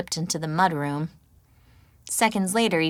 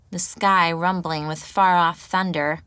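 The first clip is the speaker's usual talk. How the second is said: usual speaking voice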